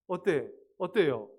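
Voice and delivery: ordinary talk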